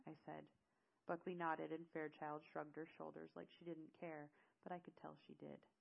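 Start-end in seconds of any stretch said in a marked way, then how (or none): none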